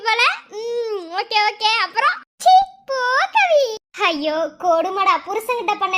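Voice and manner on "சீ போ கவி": high, coy brush-off; bashful protest. Acted. Woman teased about another baby, bashfully protesting. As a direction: shoo the teaser away, embarrassed.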